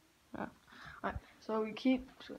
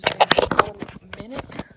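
slow and loud; 14 dB louder, much slower than usual. Real, not acted. Repeats promise to show pictures.

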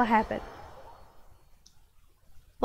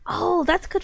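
ordinary delivery

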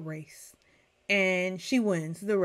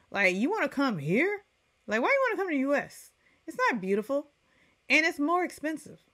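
incredulous; 7 semitones higher than usual. Real, not acted. Incredulous questioning of islanders.

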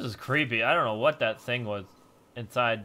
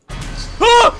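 startled scream; 19 dB louder than usual. Real, not acted. Startled by a jump scare.

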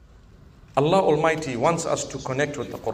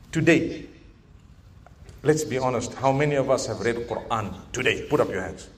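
ordinary talk, nothing marked

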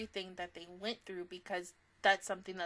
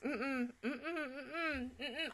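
whimpering hum; 3 semitones higher, 8 dB quieter, much slower than usual. Real, not acted. Distressed reaction to scene.

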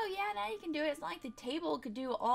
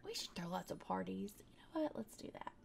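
whispered aside; 10 semitones lower, 9 dB quieter than usual. Real, not acted. Conspiratorial aside.

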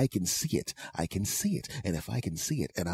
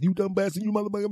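high, loud angry yell; mock yelling bus riders; 13 semitones higher, 3 dB louder, faster than usual. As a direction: shriek it like furious bus passengers. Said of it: "are yelling at his punk ass".

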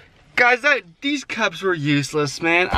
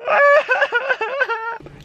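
high laugh. Real, not acted. Laughs after mock crying.

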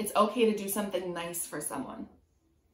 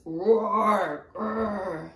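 much louder roaring lion growl; 7 dB louder than usual. Pretend. Teacher voicing the book's lion, a loud roar. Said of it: "It says".

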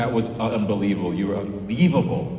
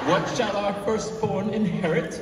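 mock archaic suitor; 10 semitones higher than usual. Joining mock old-fashioned suitor.

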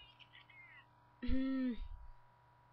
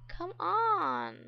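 high, loud drawn-out come on; exasperated plea; 7 semitones higher, 7 dB louder than usual. Real, not acted. Player pleading with her sleepy Sim, exasperated. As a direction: beg the screen, fed up.

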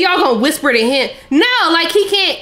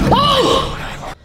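sudden high "oh" of shock; startled cry; 11 semitones higher than usual. Real, not acted. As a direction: yelp in surprise. Startled while hushing.

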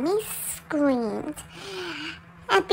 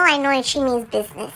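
ordinary delivery

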